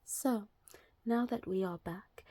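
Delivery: soft, breathy ASMR murmur. Shown as a demonstration. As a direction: ease into a gentle ASMR hush. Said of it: "little A S M R voice".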